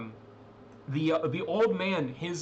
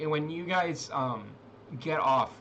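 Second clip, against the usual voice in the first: ordinary talk, nothing marked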